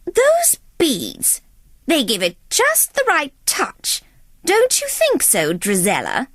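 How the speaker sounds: lightly voiced scheming stepmother; a hint of a sweet, sly jab. A narrator's reading. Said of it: "the stepmother observed".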